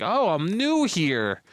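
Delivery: high, slow, put-on innocence; naive newcomer. Acting a naive newcomer's pickup line.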